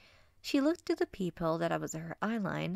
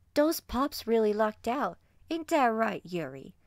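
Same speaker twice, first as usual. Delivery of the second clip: put-on loud, higher Barks-accented hyena; 3 semitones higher, 5 dB louder than usual. Hyena prodding her smaller mate, Barks accent. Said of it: "Ada said in her Barks accent".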